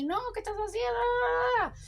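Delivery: high, startled plea, pulled out slowly; a sister's shocked stop; alarmed cry. Retold or imitated. Alten catches her brother at the bedside, high alarmed cry.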